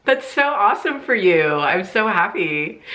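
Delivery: high, bright, forced enthusiasm; fake cheerful. Congratulating her sister while hiding the hurt, fake cheer. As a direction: paste on a happy voice over the hurt. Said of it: "in my composure, I kept it".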